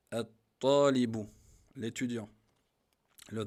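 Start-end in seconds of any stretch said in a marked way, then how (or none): 0.1-1.3 at-talibu drawn out and loud, the t doubled; slow demo word. Shown as a demonstration. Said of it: "Le ta". (at-talibu, lentement, t doublé)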